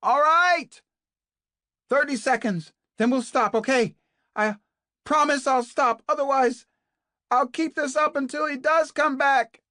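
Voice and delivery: insistent shout. A narrator's reading. Kate bargains loudly with Naomi, insistent shout. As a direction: shout the deal through the walls. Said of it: "was back on the air".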